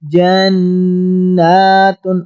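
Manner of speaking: جَنَّاتٌ with the doubled noon held long in the nose, slowly; ghunna on noon. Shown as a demonstration. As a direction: stretch the نّ in جَنَّاتٌ as a nasal hum. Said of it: "گنہ کریں گے".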